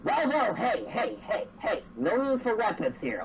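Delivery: lightly acted creature calling for a halt; startled "hold on there" plea; alarmed mimic. Mimic protests at drawn weapon.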